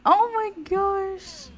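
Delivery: high, drawn-out cooing that builds to loud; delighted gushing. Real, not acted. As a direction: gush from a coo up to a squeal. Overcome by cute couple.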